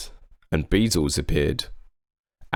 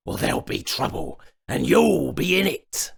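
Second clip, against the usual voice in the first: goblin king threat; 9 semitones higher than usual. A narrator's reading. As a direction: drag out the threat, hammed-up villain. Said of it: "he said".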